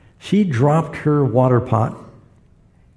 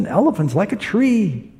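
blind man; 8 semitones higher than usual. Retold or imitated.

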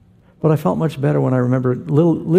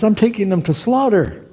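higher-pitched Israeli shepherd voice; Israeli accent; 8 semitones higher than usual. Retold or imitated. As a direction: imitate an insistent Israeli man, pitched up. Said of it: "Israeli accent".

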